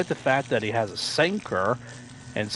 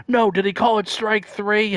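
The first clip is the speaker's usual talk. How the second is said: much higher and louder, astonished question; announcer disbelief; 11 semitones higher, 4 dB louder than usual. Retold or imitated. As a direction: ask it like you can't believe your eyes. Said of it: "the announcer goes".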